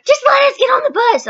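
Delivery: high-pitched imitated crowd yell; mock scream. Kids waiting outside at the bus driver, mock screaming. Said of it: "everyone's screaming at her".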